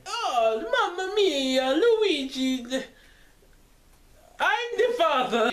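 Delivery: high, slow mock Italian Mario. Mario confessing to Luigi, hammed-up Italian accent. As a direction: ham up the Italian plumber confessing. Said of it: "Mario having an affair".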